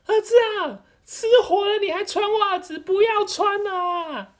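hammed-up shrill mother yelling; frantic mother. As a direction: panic at the son about the fire. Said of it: "然后他妈就说".

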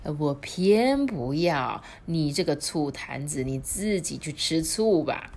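plain reading voice

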